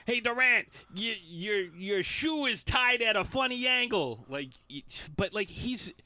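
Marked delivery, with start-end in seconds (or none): 0.0-4.2 troll Durant online; mock troll. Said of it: "another troll tweet".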